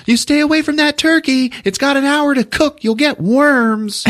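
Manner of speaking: very high scolding mother voice; yelling mom. Acted. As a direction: nag loudly from upstairs. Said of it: "still yelling at him".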